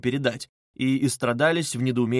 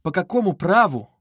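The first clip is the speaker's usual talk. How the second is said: indignant, louder challenge; mildly affronted question; offended cry; 5 semitones higher, 5 dB louder than usual. A narrator's reading. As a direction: demand it with raised pitch. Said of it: "вскричала обиженная девушка".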